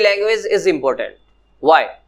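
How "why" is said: ordinary talk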